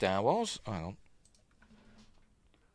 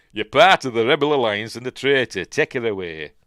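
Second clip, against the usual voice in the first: put-on loud, broad Yorkshire accent; 3 semitones higher, 8 dB louder than usual. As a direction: declaim it in thick Yorkshire. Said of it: "From Yorkshire, he'd be saying".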